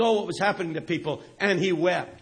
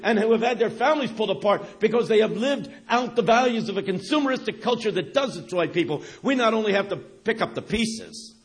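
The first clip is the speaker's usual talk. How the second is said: ordinary talk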